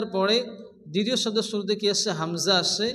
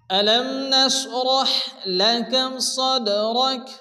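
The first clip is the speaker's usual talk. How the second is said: slow, melodic tajweed recitation; Quran recitation; 3 semitones higher, much slower than usual. Shown as a demonstration. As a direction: chant the verse slowly and tunefully. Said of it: "reciting the verse with tajweed". (ধীর সুরেলা কুরআন তিলাওয়াত)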